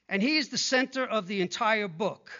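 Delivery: normal talking voice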